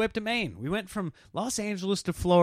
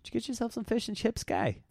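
soft, higher-pitched bad Cockney; attempted Cockney accent; 8 semitones higher, 5 dB quieter than usual. Husband asking wife about fish and chips, mock Cockney accent. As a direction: slip into a hammed-up Cockney accent. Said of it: "Look at us trying to slip seamlessly".

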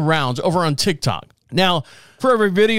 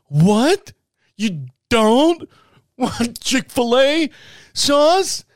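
high, rising disbelieving question; can't believe what she's hearing; incredulous; 7 semitones higher, much slower than usual. Retold or imitated. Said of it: "the face that she makes, like".

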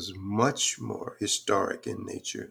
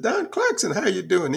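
much higher, bright mock-friendly greeting; cheery friend's greeting; 10 semitones higher, 6 dB louder than usual. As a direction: imitate a friend happily greeting him by name. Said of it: "And the person said".

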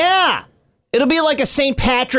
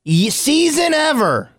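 higher, excited weather brag; mock bragging; 7 semitones higher than usual. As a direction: gush about the lack of snow. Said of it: "we're all like".

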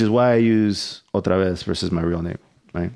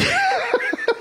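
high laugh. Real, not acted. Laughs after confession.

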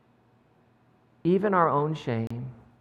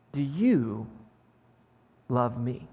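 tender question; 6 semitones lower, 4 dB quieter, much slower than usual. Acted. Jesus asks a third time.

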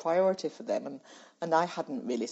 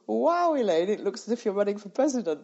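higher and louder, laughing through the words; laughing quote; 6 semitones higher, 6 dB louder than usual. Retold or imitated. American friend teasing Elaine about her long itinerary, laughing. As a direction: tease her with a laugh in your voice.

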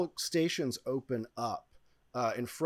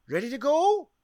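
high, loud rising call; ride attendant calling out; 12 semitones higher, 6 dB louder than usual. Acted. Attendant at her station checking riders, high excited call.

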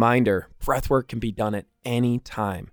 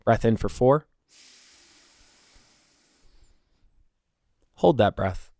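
calm, soft breathing cues; 3 semitones lower, 8 dB quieter than usual. Acted. Leads a breathing exercise.

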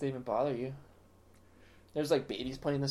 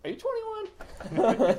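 high, slow, drawn-out questioning voice; suspicious casino checker; 6 dB louder, much slower than usual. Retold or imitated. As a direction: stretch it out like a nosy bouncer. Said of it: "he's like".

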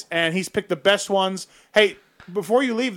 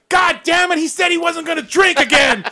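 angry; 9 semitones higher, 3 dB louder than usual. Retold or imitated. Angry father quoted in story.

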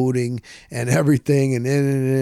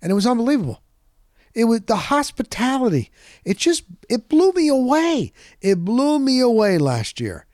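higher-pitched, real amazement; amazed gushing; 8 semitones higher than usual. Real, not acted. Podcaster recalling a host's hospitality, amazed gushing. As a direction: gush with pitch leaping on the key words.

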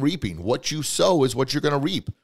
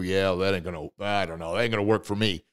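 lower, mumbled doubt; doubtful skeptic; 3 semitones lower than usual. Retold or imitated. Speaker mimicking his own early doubt, low and skeptical. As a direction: shrug it off, unconvinced. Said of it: "I'm probably like a lot of you".

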